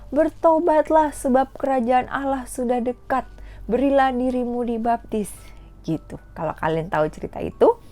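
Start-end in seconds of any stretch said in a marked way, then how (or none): 0.1-5.3 prophet shouting. Retold or imitated. Teacher voicing John the Baptist calling crowds to repent, shouting. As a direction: call out like John at the river. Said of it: "dia teriak-teriak".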